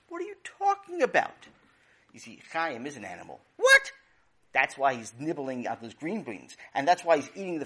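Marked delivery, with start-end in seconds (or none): 0.1-1.5 high, rising shocked question; a mother startled by her son's words; high-pitched disbelief. Acted. 3.5-3.9 high, loud indignant yelp; a kid yelping in disbelief; shrill high outraged what. Acted. Brother outraged at insult.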